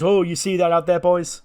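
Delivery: high, loud announcer-like character voice. Retold or imitated. Wrestler hollering at his tag partners, high and loud. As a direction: boom it out to the boys. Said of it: "He goes".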